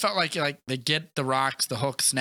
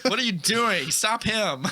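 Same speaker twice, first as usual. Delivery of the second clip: high, urgent plea at the screen; egging a hero on, pitched up; excited yelling at screen; 8 semitones higher, 3 dB louder, slower than usual. Retold or imitated. Fan yelling at Ant-Man on screen, high and urgent.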